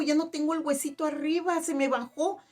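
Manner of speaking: high-pitched, excited retelling of her amazement; imitating an overjoyed woman; retold tearful joy. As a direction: report the healing in her astonished voice. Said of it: "empieza a llorar y a llorar".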